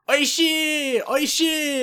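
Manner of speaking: very high, loud drawn-out hawker's cry; high-pitched calling out, full voice; street vendor's shout. Retold or imitated. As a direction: imitate a vendor yelling to passers-by. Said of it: "he's just shouting".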